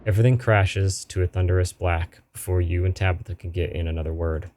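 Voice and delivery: normal talking voice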